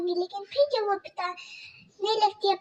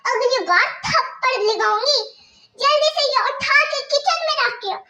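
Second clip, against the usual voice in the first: higher, louder mocking stepmother voice; harsh stepmother scolding; 5 semitones higher, 5 dB louder than usual. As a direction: bark orders at the girl like a cruel stepmother. Stepmother threatens slap.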